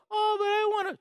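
put-on high whiny kid voice; childish whine. Grounded kid whining to parent, high whiny voice. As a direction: whine it like a kid who wants out.

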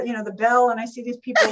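ordinary talk

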